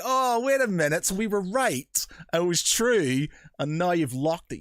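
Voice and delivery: high, smug told-you-so; gloating triumph. Retold or imitated. Host answering Reddit critics, high smug gloating. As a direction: rub their noses in it. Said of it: "I was just like".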